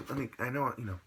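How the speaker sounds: ordinary delivery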